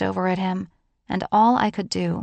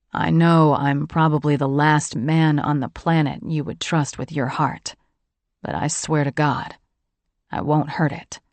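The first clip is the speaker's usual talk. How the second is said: lower, earnest man's vow; sincere John; 4 semitones lower than usual. A narrator's reading. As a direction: promise it low and earnest.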